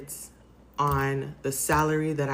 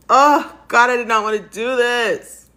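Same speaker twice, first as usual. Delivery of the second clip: much higher and louder, breaking into sobs; crying out; 9 semitones higher, 10 dB louder than usual. Real, not acted. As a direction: let the cry burst out of you. Breaking down on camera.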